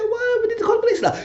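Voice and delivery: high falsetto shout, frantic; Esperanza shouting. Retold or imitated. Esperanza begging Steven to call police, high frantic falsetto shout. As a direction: yell it like a panicked woman in falsetto.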